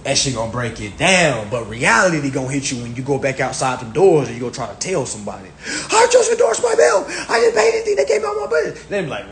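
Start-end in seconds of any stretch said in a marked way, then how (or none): 5.9-8.9 much higher, shouted bragging, fast at the end; excited shout. Retold or imitated. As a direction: crow it out like big news. Telling outsiders he endorsed bill.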